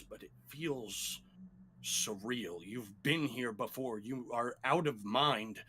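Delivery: ordinary delivery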